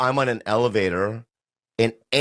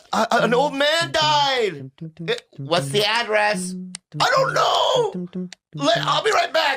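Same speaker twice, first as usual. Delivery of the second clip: very high, panicked cry-shout; hammed-up hysterical caller; 10 semitones higher, slower than usual. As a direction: fall apart on the phone.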